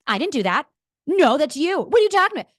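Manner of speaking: put-on high, loud whiny child denying; mock child protesting; childish denial. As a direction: play a kid caught out. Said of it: "What does a child do?".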